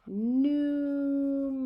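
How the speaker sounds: numerator stretched out syllable by syllable; very elongated, sounded-out numerator; slow dictation. Shown as a demonstration. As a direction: draw numerator out long and slow for note-takers. Dictating for students to write.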